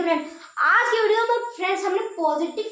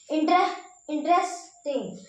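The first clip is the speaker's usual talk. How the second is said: Interesting slowly split, टिंग set apart; broken into parts; slower than usual. Shown as a demonstration. Teacher working out syllables, interesting slowly broken up. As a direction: break off at inter, restart and pause before टिंग. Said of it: "टिंग पे आप थोड़ा रुकना है".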